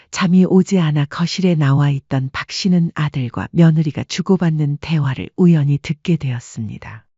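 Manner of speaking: ordinary talk, nothing marked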